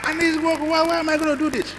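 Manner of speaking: high, whining plea; pleading son. Retold or imitated. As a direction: beg like a lazy teenager. Son protests washing by hand.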